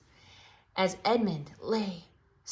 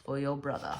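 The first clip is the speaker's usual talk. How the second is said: lightly voiced lion Aslan; 3 dB quieter than usual. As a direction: speak as a grave, kindly lion king. Aslan's voice, low.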